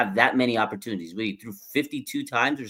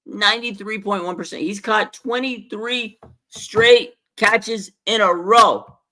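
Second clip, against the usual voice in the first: rising higher and louder to a loud peak; building hype; 7 semitones higher, 4 dB louder than usual. Real, not acted. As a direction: build it up until you're almost shouting. Building up Kittle's record streak.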